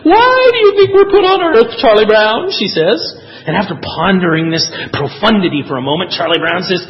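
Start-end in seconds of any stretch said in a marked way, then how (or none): 0.1-2.5 loud, high-pitched cartoon philosophizing; high, declaiming cartoon-character voice; Lucy. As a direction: pipe up high and loud with a big question. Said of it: "Lucy is waxing philosophically".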